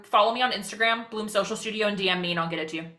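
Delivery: rapid-fire, rushing through; racing through the words; very fast talking. Real, not acted. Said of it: "She talks so fast".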